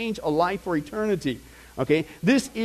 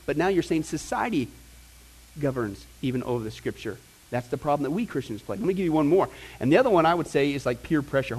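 ordinary talk, nothing marked

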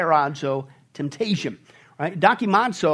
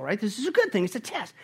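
ordinary delivery

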